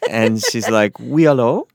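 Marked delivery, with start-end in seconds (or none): 1.0-1.7 a lightly voiced cute French girl, higher; hint of a charming French girl answering the door. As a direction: give a sweet, lilting French hello. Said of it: "all cute and French".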